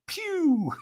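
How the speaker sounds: high "pew" zooming noise; high whoosh breaking into a laugh; imitated whoosh. Teller shoots off onto the coals, imitated whoosh.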